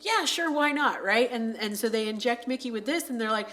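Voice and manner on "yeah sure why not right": a touch higher, casually agreeing; mock-easy yes from a drugged man; lightly voiced woozy patient; dazed patient. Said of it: "And he's like".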